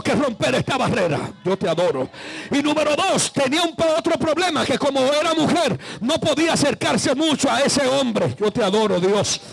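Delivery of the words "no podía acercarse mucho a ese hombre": ordinary talk, nothing marked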